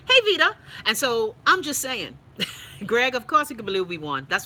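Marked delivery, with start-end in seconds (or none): 0.1-0.6 high, loud happy hello; excited greeting. Real, not acted. Spotting a viewer in chat, high loud greeting. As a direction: call out a sudden cheery hi.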